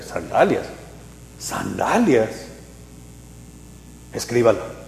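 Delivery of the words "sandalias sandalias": puzzled murmur. Retold or imitated. Believer repeating the word.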